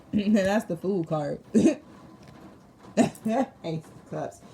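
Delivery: can't help laughing at it; amused laughing. Real, not acted. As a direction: talk through bursts of laughter. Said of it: "I'm sorry, it's not funny".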